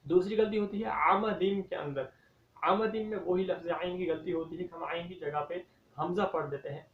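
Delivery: ordinary talk, nothing marked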